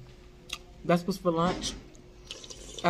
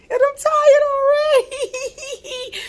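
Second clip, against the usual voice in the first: laughing; 10 dB louder, much slower than usual. Real, not acted. Lunchtime, laughing about being tired.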